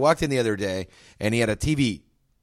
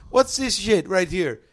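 slow, high put-on shopkeeper voice; gas station owner voice; 6 semitones higher, much slower than usual. Gas station owner griping at the TV, high and slow. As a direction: drag it out, pitched up like the owner. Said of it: "And he goes".

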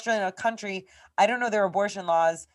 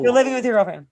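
louder, higher insistent retort; insistent confrontation; 4 semitones higher, 6 dB louder, faster than usual. Retold or imitated. Woman rejecting the guy's excuse about his girlfriend, insistent. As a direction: hammer the fact home after a weak excuse.

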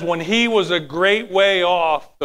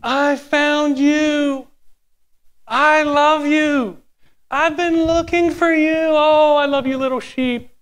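higher, very slow tender cooing to the sheep; rejoicing shepherd; 8 semitones higher, slower than usual. Acted. God the shepherd rejoicing over the lost sheep, tender cooing. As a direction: coo over the found lamb with delight. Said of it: "he picks the sheep up rejoicing".

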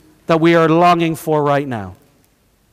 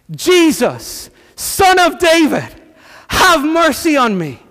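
high, pleading call across a street; crying out; 11 semitones higher, 4 dB louder than usual. Retold or imitated. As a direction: call out to be noticed, pitched high. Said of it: "And he shouts".

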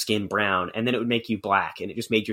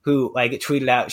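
ordinary talk

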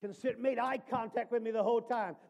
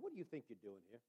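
much lower, much softer question of God; softly spoken God; quiet voice of God; 12 semitones lower, 17 dB quieter, much faster than usual. Acted. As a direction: hush it like a still voice. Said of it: "God speaks to Elijah and says".

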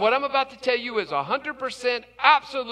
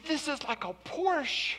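awed; 6 semitones higher, 7 dB quieter than usual. Retold or imitated. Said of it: "He is so excited".

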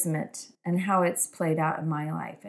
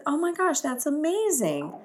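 delighted surprise; 9 semitones higher than usual. Retold or imitated. Her own retold reaction.

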